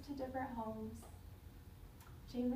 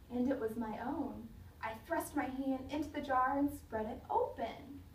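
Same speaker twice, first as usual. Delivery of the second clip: ordinary delivery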